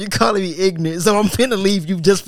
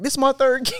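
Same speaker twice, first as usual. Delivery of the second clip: high mimicked girlfriend; high-pitched teasing imitation; mock girlfriend; 7 semitones higher than usual. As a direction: blurt it pitched way up.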